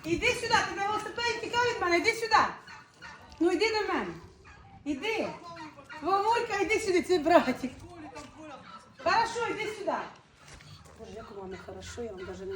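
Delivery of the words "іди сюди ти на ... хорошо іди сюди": laughing call. Real, not acted. Coaxing brother over, laughing.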